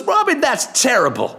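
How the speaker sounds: high put-on [name] voice, mock shocked; [name] mocking the absent boss; [name] impression. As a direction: play [name], mock outrage. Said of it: "He goes".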